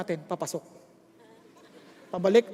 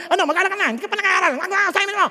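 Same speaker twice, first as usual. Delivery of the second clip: a nagging parent's voice, high and loud; 8 semitones higher, 6 dB louder, much faster than usual. Retold or imitated. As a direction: scold the child about homework. (bahagyang ginagaya ang nagbubungang magulang)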